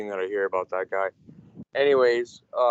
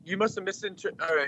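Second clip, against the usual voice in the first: usual speaking voice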